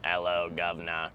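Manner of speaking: put-on low, soft British accent; hint of a stock British accent. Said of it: "do a British accent".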